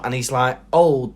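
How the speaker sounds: usual speaking voice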